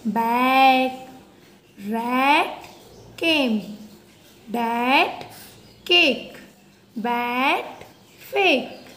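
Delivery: loud, clear word-by-word reading; reading a word list. Shown as a demonstration. Teacher reading words aloud for pupils to repeat. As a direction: call each word out to the class.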